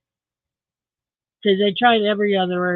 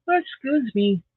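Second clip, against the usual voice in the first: ordinary talk, nothing marked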